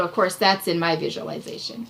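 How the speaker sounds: normal talking voice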